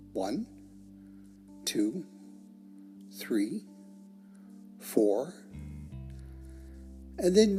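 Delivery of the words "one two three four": slow, spaced count for breathing in; measured breathing count. Shown as a demonstration. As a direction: count evenly with a long pause after each number. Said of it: "the count goes like this".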